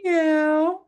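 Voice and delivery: very high squeaky cat meow; a tiny kitten mewing up high; kitty meow. Shown as a demonstration. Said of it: "with me again".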